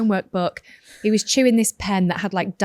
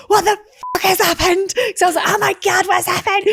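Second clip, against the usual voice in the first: much higher, louder panicked cry; panicked shriek; 12 semitones higher, 5 dB louder than usual. Retold or imitated. Mum recalling her panic at son's split lip, shrieking. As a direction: shriek in alarm at the blood. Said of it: "my reaction as a mum to be a calm parent was".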